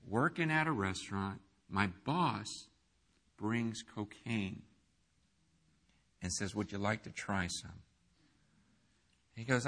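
low and very soft, confiding tone; hushed storytelling. Retold or imitated. The pastor retells James's cocaine story to the church in a low, hushed voice. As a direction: speak it like a secret confession.

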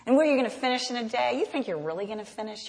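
fast mocking jabs; fast taunting. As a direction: rattle off the taunts quickly. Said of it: "See the hiss?".

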